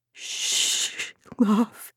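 soft, gentle shush; tender whispered warning; hushing whisper. A narrator's reading. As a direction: hush a frightened child. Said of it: "the woman whispered".

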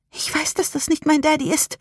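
put-on high, hushed frightened girl; desperate whisper. A narrator's reading. Rebecca knows it is not her daddy, desperately whispering. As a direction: plead in a small, high child's voice. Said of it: "flüsterte Rebecca verzweifelt".

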